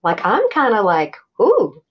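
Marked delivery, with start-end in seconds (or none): 1.4-1.8 a quick, high, excited ooh; a light, mock-giddy ooh. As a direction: squeal a little at the novelty. Giddy at moving in together.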